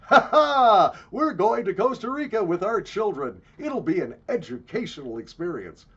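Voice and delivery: loud, hammed-up self-satisfied tourist; mock well-off dad showing off; smug corporate parent. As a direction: boast with big fake laughs and swooping pitch. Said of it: "Mom and Dad Corporate".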